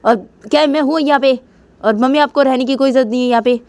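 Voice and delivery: high-pitched, heated self-justification; worked-up, irritated outburst; angry. Acted. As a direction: defend yourself in acted anger. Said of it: "मुझे भी बहुत गुस्सा आ रहा है".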